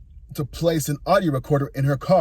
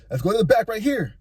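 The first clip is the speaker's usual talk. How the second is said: higher, light echo of his words; wife's voice; 7 semitones higher than usual. Retold or imitated. Wife echoing the guy, higher and teasing. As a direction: lift it up into her teasing voice. Wife echoes guy's line.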